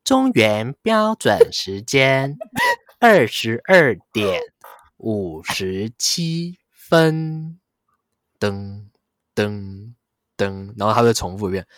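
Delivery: slow, deliberate phone clock announcer; time announcement voice. Retold or imitated. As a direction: read the time like the recorded hotline. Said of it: "你不是打那个电话".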